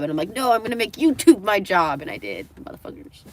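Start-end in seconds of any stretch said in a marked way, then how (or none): 0.3-2.0 louder, higher, insistent comeback; emphatic acted defiance; retold defiant reply. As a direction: stand your ground against the naysayers.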